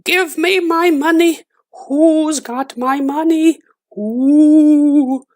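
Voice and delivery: very high, slow, eerie ghostly wail; ghost crying. A narrator's reading. Dead woman's ghost calling for her money, high eerie wail. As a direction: moan like a far-off ghost hunting her money. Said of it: "the voice way off cried".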